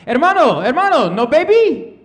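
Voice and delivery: put-on taco joint guy teasing in a light Spanish accent. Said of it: "he's like".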